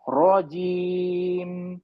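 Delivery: رجیم held very long, lips closed at the end; very slow model reading of رجیم; drawn-out recitation demo. Shown as a demonstration. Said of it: "پھر سے پڑھیے".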